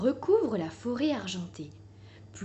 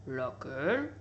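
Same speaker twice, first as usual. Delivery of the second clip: gruff badger; 8 semitones lower, 7 dB quieter, slower than usual. A narrator's reading. Badger shopkeeper voiced low.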